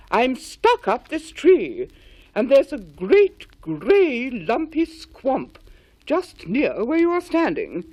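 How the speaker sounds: slow, loud alarmed call; urgent warning. A narrator's reading. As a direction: warn them anxiously from above. Henry warning from tree.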